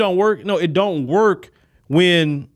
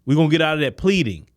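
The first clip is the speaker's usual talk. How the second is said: ordinary talk, nothing marked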